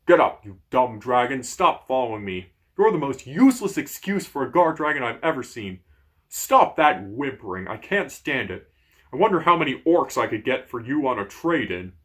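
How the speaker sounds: loud, gruff giant scolding; angry giant. Acted. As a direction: berate the whimpering dragon. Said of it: "The giant is not pleased".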